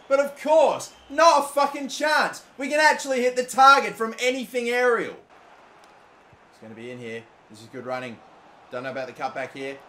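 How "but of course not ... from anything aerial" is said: loud, high sarcastic complaint; exasperated rant. Real, not acted. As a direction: complain loudly at the miss. Header misses again.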